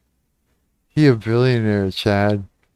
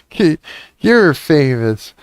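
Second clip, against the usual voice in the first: ordinary talk, nothing marked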